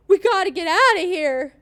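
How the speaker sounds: hammed-up panicked line, high and loud; Mickey. Host mocking the actress's overdone line in the episode, overacting. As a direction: push it super hard, like an overacting actress. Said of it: "She was pushing everything super hard".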